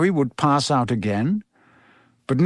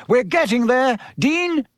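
high, urging call; Professor Lupin; 8 semitones higher than usual. A narrator's reading. Teacher calling the next pupil in, high narrated shout. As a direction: urge them on with pitch well up. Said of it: "shouted Lupin".

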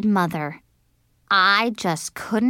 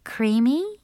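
high questioning lilt; tentative girl's question; unsure girl; 4 semitones higher than usual. A narrator's reading. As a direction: try the name out, rising. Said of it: "Cinderella thought for a second".

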